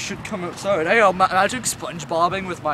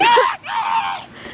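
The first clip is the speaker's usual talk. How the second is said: very high imitated bird screech; seagull-like squawk; 4 dB louder than usual. Imitating birds, mistaken for seagulls.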